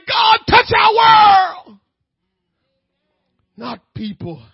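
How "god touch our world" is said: loud, high pleading shout, voice near breaking; crying prayer. Acted. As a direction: cry out to God with everything. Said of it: "and say".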